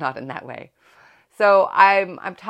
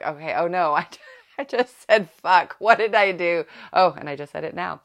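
mock panic; 4 semitones higher, 8 dB louder than usual. Panicking at parent evening after swearing.

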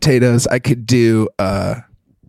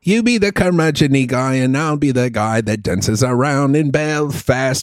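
put-on jovial Irish accent; 3 semitones higher than usual. Host riffing as his jovial Irishman, Irish accent. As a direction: play a jovial Irishman, stretching the vowels. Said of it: "your Irish guy is, like, the jovial guy".

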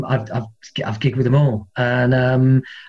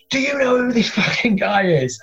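higher, pushy boast; excited fan; 7 semitones higher than usual. Retold or imitated. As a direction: show off your hero to the room. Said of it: "they're going".

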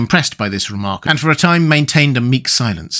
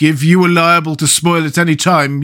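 raised, aggrieved storybook protest; 5 semitones higher than usual. A narrator's reading. Pumpkinhead explains his fear of spoiling, protesting. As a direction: plead your case, pitched up. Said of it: "protested poor Jack".

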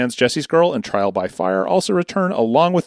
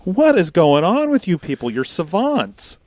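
amazed disbelief; 6 semitones higher, slower than usual. Retold or imitated. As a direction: marvel at the listeners in mock disbelief. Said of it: "And I'm like".

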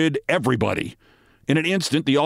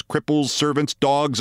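ordinary delivery, nothing marked

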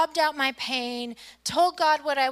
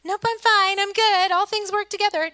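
higher, louder, over-insistent "I'm fine"; mock chipper denial; 7 semitones higher, 6 dB louder than usual. Churchgoer brushing off a neighbor's question, mock chipper. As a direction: imitate a reflex cover-up, pitched up and loud. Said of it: "I immediately want to revert to".

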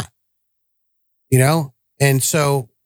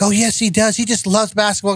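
higher, insistent mock wife; insistent wife imitation; 8 semitones higher than usual. Husband mimicking wife insisting the son loves basketball, higher and pushy. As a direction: echo the wife, pitched up and pushy.

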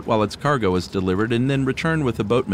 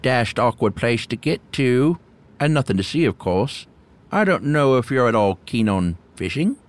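put-on creaky old hermit; 2 semitones higher than usual. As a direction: play the awkward old hermit. Voices the hermit.

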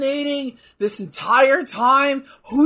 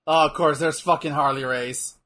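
ordinary delivery

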